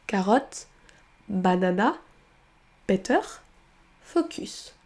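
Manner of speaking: carotte, banana, better, focus with every vowel said as written; mock learner mispronunciation with full vowels; French spelling pronunciation. Shown as a demonstration. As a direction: read each letter the French way. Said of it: "on aura tendance à remplacer le son schwa par la lettre qui est écrite".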